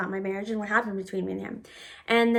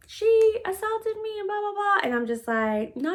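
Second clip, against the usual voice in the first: mock hollering; 10 semitones higher than usual. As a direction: imitate her wailing accusation in a high voice. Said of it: "she's screaming and hollering".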